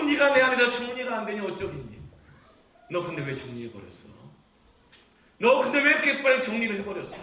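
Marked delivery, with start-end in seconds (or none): 5.4-7.2 loud, high anguished plea; pleading cry. Shown as a demonstration. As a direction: cry out the question to the one who left. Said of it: "repeated question, soft then loud". (떠난 자식에게 외치는 하나님의 절규)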